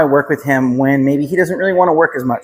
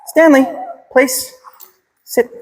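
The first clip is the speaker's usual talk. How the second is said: high-pitched dog command; 11 semitones higher, slower than usual. Shown as a demonstration. Trainer showing the owners the command again. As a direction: call him up high to get his attention. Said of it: "Let me show you again".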